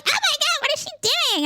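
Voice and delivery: high, hammed-up squeal of a flustered parent; mock the useless panicking parents; mock panicked parent. Mocking friend's parents who panic, high squeal. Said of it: "parents who are just like".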